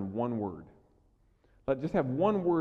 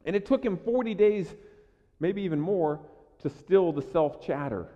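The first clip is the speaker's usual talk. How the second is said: ordinary talk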